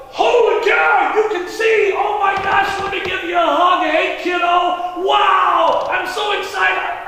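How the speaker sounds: high, loud, drawn-out whoops of joy; excited rejoicing. Acted. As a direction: gush like an overjoyed neighbor. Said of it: "they couldn't go".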